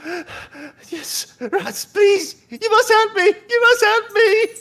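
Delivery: high, loud falsetto begging; desperate plea. Acted. Farm woman begging the party to save her barn, high and loud. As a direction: plead loudly with a high panicked voice.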